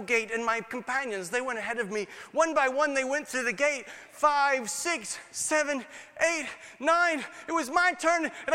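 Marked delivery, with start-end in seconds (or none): none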